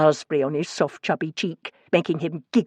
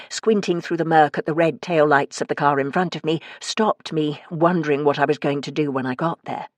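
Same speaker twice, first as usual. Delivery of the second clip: ordinary delivery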